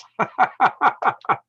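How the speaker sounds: long, high-pitched laugh; embarrassed laugh. Real, not acted. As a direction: laugh off your own slip. Laughs at his screen-share mistake.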